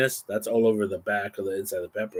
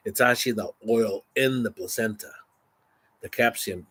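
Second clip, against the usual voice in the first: ordinary delivery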